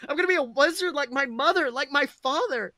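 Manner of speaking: put-on high, loud eager boy; excited. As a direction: burst out with a kid's giddy hope. Child dreaming of being a wizard.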